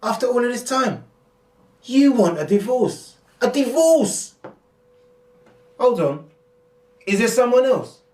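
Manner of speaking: shrill melodrama of a stunned husband; hammed-up shock. Staged quarrel with Hannah.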